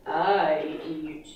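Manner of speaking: low, slow, menacing alligator. Acted. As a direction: growl it slowly like a hungry alligator. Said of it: "said the alligator".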